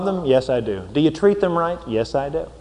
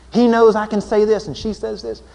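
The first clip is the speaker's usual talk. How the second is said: ordinary delivery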